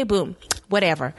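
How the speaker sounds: ordinary talk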